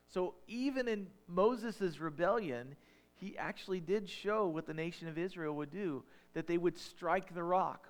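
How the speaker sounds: rising preaching. Real, not acted. Preacher driving home Christ to the congregation, fervent preaching. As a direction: build toward Christ with fervor. Preaching climax, call and answer.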